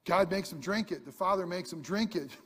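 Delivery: normal talking voice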